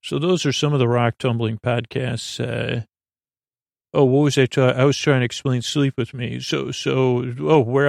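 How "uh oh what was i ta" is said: ordinary talk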